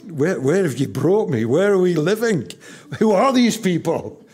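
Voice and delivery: put-on young wife dismayed in a new town. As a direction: sound lost and upset as Jane. Jane's dismayed reaction voiced.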